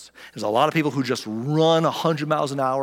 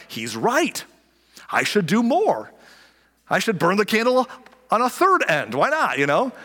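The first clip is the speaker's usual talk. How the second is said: higher, louder earnest voice; eager workaholic; 8 semitones higher, 3 dB louder than usual. Retold or imitated. Pastor imitating the overbusy, higher and eager. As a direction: sound keen to do more. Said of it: "are like".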